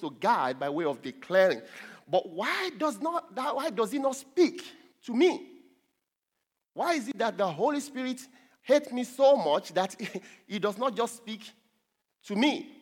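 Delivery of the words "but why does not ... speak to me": puzzled complaint. Acted. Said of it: "somebody will say".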